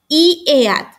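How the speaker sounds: "I" said as Spanish "ee"; "I, eat" as "ee eat", by the spelling; students' wrong pronunciation. Retold or imitated. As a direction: mimic learners reading "I" as "ee". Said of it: "ellos dicen".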